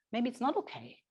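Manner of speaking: ordinary delivery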